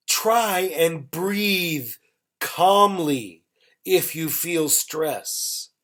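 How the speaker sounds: very slow, phrase by phrase; unhurried teacher's example with gaps; slow model sentence. Shown as a demonstration. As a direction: draw out each phrase for learners, pausing between them. Teacher models example slowly, then repeats.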